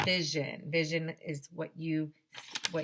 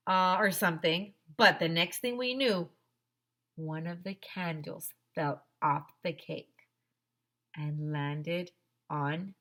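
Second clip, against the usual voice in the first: plain reading voice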